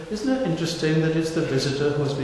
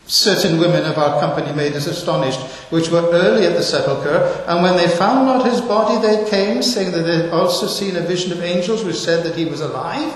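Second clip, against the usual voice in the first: raised, solemn reading of the verse; scripture reading; 4 semitones higher, 5 dB louder than usual. Acted. Preacher reading the disciples' words to the church, raised and solemn. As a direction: read it out to the congregation with weight.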